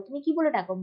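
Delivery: ordinary delivery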